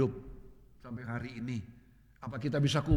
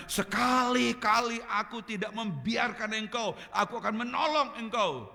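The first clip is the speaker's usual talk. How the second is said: quoting God, preached; 8 semitones higher, 3 dB louder than usual. Retold or imitated. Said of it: "ketika kita dengar firman Tuhan bilang".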